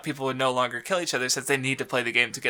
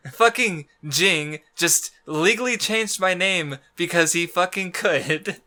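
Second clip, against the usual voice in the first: slow, higher put-upon grumble; griping Dwon; 4 semitones higher, slower than usual. Retold or imitated. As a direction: complain like someone wronged for no reason. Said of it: "where he's just like".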